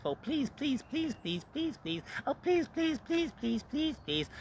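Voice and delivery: very high-pitched desperate begging, repeated without a break; mock pleading. Ranting speaker mocks a book as a begging desperado, mock pleading. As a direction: beg for your life in an exaggerated high voice. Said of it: "begging and pleading for its life".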